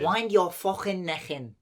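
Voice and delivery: slow Scouse telling-off; guttural Scouse scolding, slowed down; Scouse accent. Shown as a demonstration. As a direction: draw it out in a Liverpool accent. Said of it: "That's how Scout".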